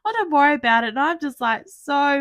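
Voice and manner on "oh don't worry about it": reassuring nurse. Retold or imitated. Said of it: "she's like".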